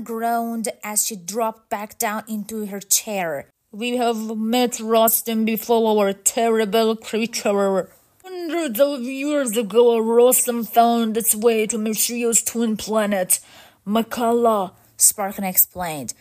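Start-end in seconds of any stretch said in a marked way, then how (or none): 3.7-14.7 steady robot elder telling of disaster; level-toned robot storyteller; robot leader, grave. Acted. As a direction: recount it as a mechanical leader.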